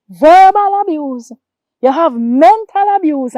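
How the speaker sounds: slow, loud, hammered words; slow, loud preacher-style emphasis; emphatic warning. Acted. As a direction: drive each abuse home slowly and loudly.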